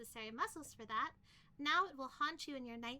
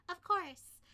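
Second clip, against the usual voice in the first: ordinary talk, nothing marked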